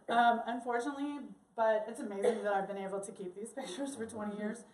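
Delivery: ordinary delivery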